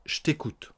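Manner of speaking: "Je" shrunk to "ch" before "t'écoute"; swallowed E of je. Shown as a demonstration. Teacher repeating the contraction, "ch't'écoute" with no E. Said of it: "On mange le E de je".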